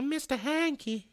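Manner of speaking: high-pitched little cartoon voice; high, playful cartoon impression; Mr Hanky. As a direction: squeak it like a cartoon character. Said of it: "Is it Hanky?".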